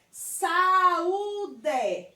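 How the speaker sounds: saúde stretched as SA-ÚÚ-de; saúde said slowly with a long middle; drawn-out syllables. Shown as a demonstration. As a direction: hold the Ú of saúde, long and loud. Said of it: "Quando a gente separa as sílabas".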